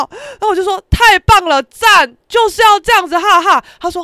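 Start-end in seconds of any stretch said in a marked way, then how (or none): 0.9-3.6 loud, high enthusiastic praise; retold cheering. Praising boxing friend's video, exuberant shout. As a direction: shout your approval word by word.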